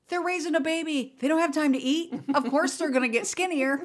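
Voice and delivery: put-on insistent child. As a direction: imitate the child insisting he knows better. Child insisting, mother imitating him.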